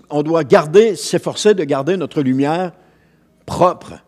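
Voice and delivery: ordinary delivery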